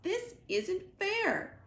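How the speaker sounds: high, slow, drawn-out squawk of protest; squawking gosling. Acted. Gosling stomps around the garden protesting to Roz, high squawk. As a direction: stretch it out like a sulking bird. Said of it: "stomped around the garden and squawked".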